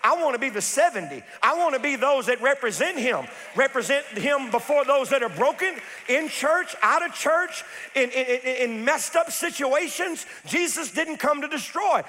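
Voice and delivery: high, loud, rhythmic preacher's cry; full-force preaching. Real, not acted. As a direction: preach it hard to the congregation.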